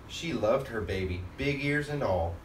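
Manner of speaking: plain reading voice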